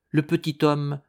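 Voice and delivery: ordinary delivery, nothing marked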